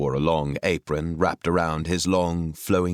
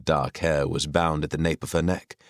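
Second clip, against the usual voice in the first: usual speaking voice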